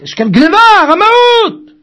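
rising to a high, loud cry on the last word; outraged shout. Acted. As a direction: cry out that he's been robbed. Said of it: "צעק וצווח". (צעקה נרגשת של סוחר)

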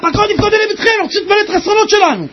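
high, urgent pleading shout; זעקה. Retold or imitated. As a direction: cry out a demand at a high, held pitch. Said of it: "הוא צעק". (זעקת תחינה גבוהה)

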